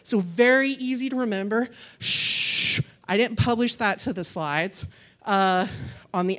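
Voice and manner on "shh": long "shh" hush; playful shush. Pretend. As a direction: hush the room as if sharing a secret. Playfully hushing audience about discount code.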